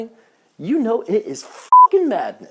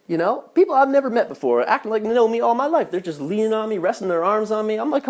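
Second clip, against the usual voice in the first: ordinary delivery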